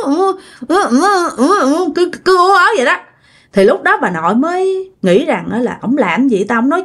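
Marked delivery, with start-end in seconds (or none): 0.1-2.1 high, strained mock moans; imitated moaning. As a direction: mimic a sleeper groaning in a dream. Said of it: "nghe ông nội cứ ứ".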